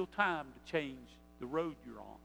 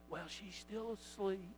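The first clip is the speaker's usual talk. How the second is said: slow, hushed whisper; whispering niece; 9 dB quieter than usual. Acted. Niece telling uncle mother still sleeps, whispering. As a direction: keep it very soft and secret. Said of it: "she whispered".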